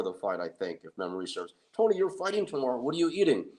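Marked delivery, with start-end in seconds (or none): 1.7-3.5 incredulous scolding. Retold or imitated. Friends scold Tony at dinner.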